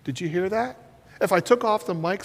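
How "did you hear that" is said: speaking softly to one person; soft spoken aside; quiet aside. Shown as a demonstration. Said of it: "if I say to my friend right here".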